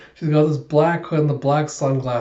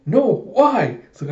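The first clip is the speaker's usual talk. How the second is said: high-pitched acted dismay; exasperated bafflement; 6 semitones higher than usual. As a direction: wail the question in comic disbelief. Lloyd baffled by Yor's change.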